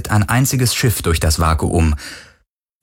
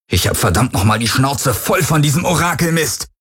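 higher, furious yelling; angry shout; 7 semitones higher than usual. Acted. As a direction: roar it in fury. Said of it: "brüllte er die Helix an". (wütend gebrüllt)

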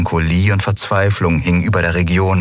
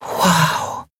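hushed amazed gasp of a word; breathed in awe; 10 semitones higher than usual. A narrator's reading. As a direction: breathe it out in wonder. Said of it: "hauchten Nelen und Miko unisono".

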